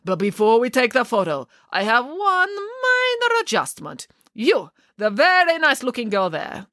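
a showy photographer holding court, unhurried; Boris voiced. Boris picks out Samantha.

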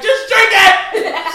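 loud, high, slow nagging mom; laughing impression of a nagging mother, high and slow; mock scolding mother. Mother scolding over Kool-Aid.

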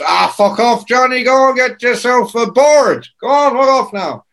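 high, boisterous brush-off in a put-on voice; exasperated rock singer impression. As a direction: imitate a singer merrily telling someone to clear off. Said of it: "And [name] goes".

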